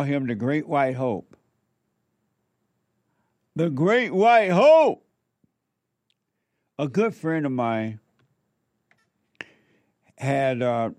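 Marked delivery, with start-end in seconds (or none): 3.5-5.1 higher and louder, a rousing slow declaration; loud proclamation. Real, not acted. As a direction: announce the title to a cheering crowd. Proclaiming Trump's title.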